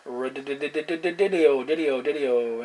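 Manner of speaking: slow sing-song mangled radio; playful radio call. Acted. CB operator teasing the quiet channel, slow sing-song call. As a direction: stretch it like a silly call sign.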